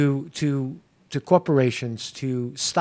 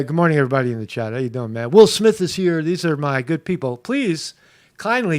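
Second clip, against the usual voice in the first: ordinary talk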